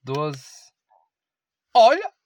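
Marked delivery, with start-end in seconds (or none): 1.7-2.1 excited. Real, not acted. Shows his lost bet.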